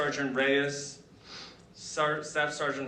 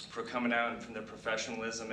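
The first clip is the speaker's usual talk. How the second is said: ordinary delivery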